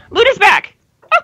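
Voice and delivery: high, excited greeting. Real, not acted. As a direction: greet the dog with delight. Dog comes home.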